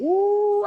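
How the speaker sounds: high, loud startled ooh; surprised ooh. Pretend. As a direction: yelp like you got hit on the head. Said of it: "And you'll open up a".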